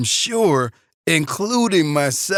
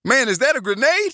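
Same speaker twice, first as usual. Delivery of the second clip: alarmed; 9 semitones higher, faster than usual. Acted. Alarmed at object.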